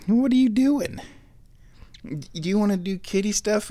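very high cooing pet voice; talking to the cat. Real, not acted.

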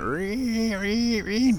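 high, drawn-out ree; imitated owl call. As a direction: mimic a weird bird cry. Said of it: "I hear that weird sound".

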